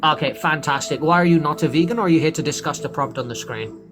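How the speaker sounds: ordinary delivery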